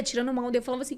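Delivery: ordinary talk, nothing marked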